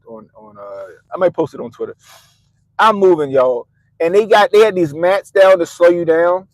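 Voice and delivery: normal talking voice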